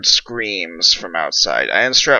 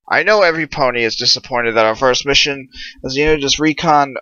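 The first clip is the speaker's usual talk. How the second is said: rallying captain; 4 semitones higher than usual. Acted. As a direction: fire up the troops, end on a shout. Captain rallies unit before mission.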